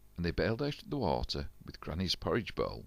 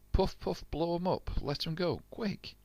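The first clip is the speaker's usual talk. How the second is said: much higher, urgent commands; 12 semitones higher than usual. A narrator's reading. Jack urging the crew to blow up balloons, high and hurried. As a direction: rush them along in a high voice.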